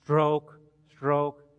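slow, measured stroke count; unhurried rowing chant, spaced out; steady rowing call. Acted. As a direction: call each stroke slowly and evenly. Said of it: "they were doing this, you know".

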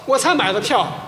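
normal talking voice

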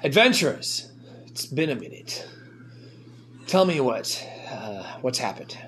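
a hint of a gruff orc greeting. Moritor greets the returning adventurers in his orc voice.

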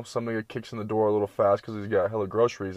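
ordinary delivery

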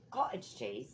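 "Cottage cheese" in a put-on British accent; mock British accent. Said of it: "people in England do that".

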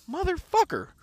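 high squeaked incredulous curse; mock disbelief. Father reacting to the other dad's taunt, high stunned curse.

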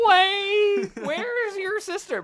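high, drawn-out comic yell; drunk friend calling. Retold or imitated. Friend running after him about his sister, high drawn-out call. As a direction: stretch each word high, calling after someone.

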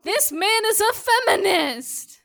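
slow, high, loud mock proclamation; hammed-up tribute; mock solemn. Real, not acted. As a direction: proclaim it with fake reverence.

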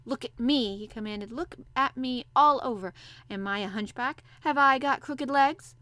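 put-on loud, commanding boy's demand; imperious little lord, emphatic and loud. A narrator's reading. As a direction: order them to look, chin up and proud. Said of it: "imperious way".

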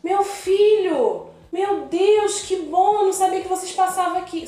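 a hint of a confused grandma; imitating old lady. Old neighbor thanks the van driver, a touch of relief.

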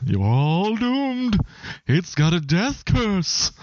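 slow, high doom-laden crazy warning; slow ominous raspy drawl. Retold or imitated. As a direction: croak out a doom prophecy slowly. Voicing Crazy Ralph's warning.

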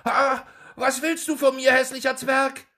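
high, loud shriek of disgust; horrified cry. A narrator's reading. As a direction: recoil from the ugly dwarf. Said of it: "mit einem Schrei des Entsetzens".

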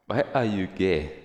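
loud mock Ugandan accent; Ugandan interviewer meme imitation. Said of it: "that clip was".

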